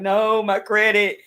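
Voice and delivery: a half-hammed despairing whine over her credit; mock crying wail. Retelling crying in bed to her ex, a mock teary wail. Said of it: "I was laid in bed just crying".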